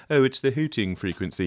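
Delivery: put-on British accent. Mixing teacher imitating a colleague's term, mock British accent. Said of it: "He does it with a British accent".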